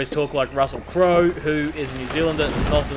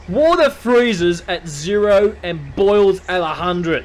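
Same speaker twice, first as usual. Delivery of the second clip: slow, loud, higher-pitched declaration; loud indignant rant; 4 semitones higher, 9 dB louder, slower than usual. Real, not acted. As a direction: proclaim it loudly, word by word. Mock rant against Fahrenheit.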